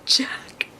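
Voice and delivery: drawn-out whispered villain name; hushed, ominous reveal; dramatic reveal. Retold or imitated. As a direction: whisper it slowly for suspense.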